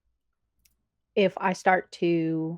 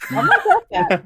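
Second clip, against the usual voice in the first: laughing tease; 8 dB louder than usual. Real, not acted. Teasing repeat, high laugh.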